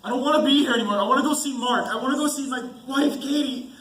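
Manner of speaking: high, loud desperate pleas; frightened outburst near tears; panicked pleading. Acted. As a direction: beg in rising panic to go home. Terrified man breaks down pleading.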